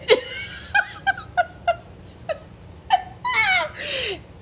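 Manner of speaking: high laughter that breaks into crying; real laugh-cry; laughter tipping into sobs. Real, not acted. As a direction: laugh until it turns to tears.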